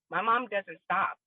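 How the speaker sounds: ordinary delivery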